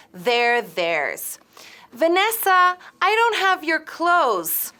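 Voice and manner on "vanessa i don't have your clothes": raised, higher voice to Vanessa; calling out to Vanessa in another room. Acted. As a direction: call across the house.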